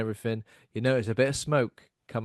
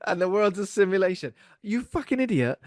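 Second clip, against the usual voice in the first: much higher, louder mocking voice; mock Elon voice; 8 semitones higher, 3 dB louder than usual. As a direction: ridicule him in a squeaky impression. Mock Elon then insult.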